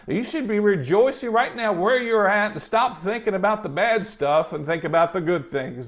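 normal talking voice

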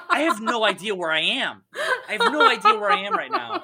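high, laughing, half-panicked. Retold or imitated. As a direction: giggle through the lost panic. Panicked drunk self-quote, laughing.

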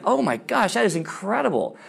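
amazed. Retold or imitated. Admiring a great performance.

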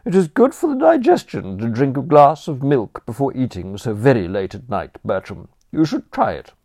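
high, loud stern aunt lecturing. A narrator's reading. Aunt lecturing Bertram about milk before eating, a touch stern. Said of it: "Aunt Agatha's voice".

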